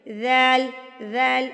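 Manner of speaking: ذل soft, tongue to teeth; gentle ذل. Shown as a demonstration. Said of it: "زبان دانتوں سے ٹچ ہوتی ہے". (ذل کو نرمی سے پڑھیں)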